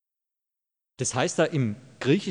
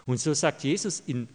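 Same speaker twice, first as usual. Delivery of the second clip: normal talking voice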